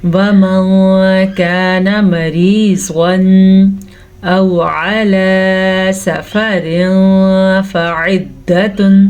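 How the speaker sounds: slow, measured tajweed chant; drawn-out Quranic recitation. Shown as a demonstration. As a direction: recite each syllable slowly and clearly. Recitation resumes.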